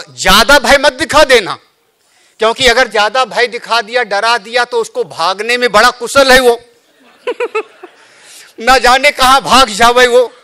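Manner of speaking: high, loud, playful caution; comic warning Rama. Acted. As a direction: warn with a teasing grin.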